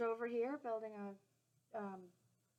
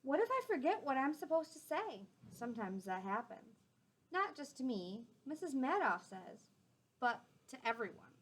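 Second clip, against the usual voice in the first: gentle storybook read-aloud; clear, friendly storytime voice; reading to children; 2 semitones higher, 4 dB louder than usual. A narrator's reading. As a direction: read it out to the class like a picture book. Reading a picture book aloud.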